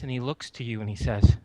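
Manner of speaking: ordinary talk, nothing marked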